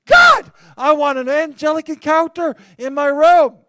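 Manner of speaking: high, loud blurted request; sudden high eager plea; blurted prayer. Retold or imitated. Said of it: "I blurted it".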